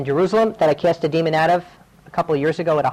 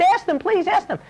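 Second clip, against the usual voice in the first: much higher, urgent pleading voice; 12 semitones higher than usual. Retold or imitated. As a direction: plead desperately to be believed. Man pleading with hostel owner.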